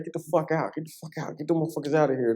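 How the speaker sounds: high-pitched agitated kick-out, retold; worked-up mock outburst, repeated in a high voice; retold angry dismissal. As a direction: act out ordering intruders out, pitch jumping up. Retold ordering detectives out of hospital.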